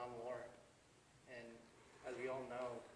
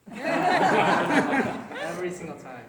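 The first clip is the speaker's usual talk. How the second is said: laughing; 6 semitones higher, 23 dB louder, much slower than usual. Real, not acted.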